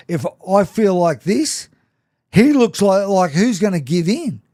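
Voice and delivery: like mid-fight, asking who'll give in first; strained inner voice. Retold or imitated.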